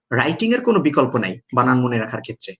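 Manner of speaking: ordinary delivery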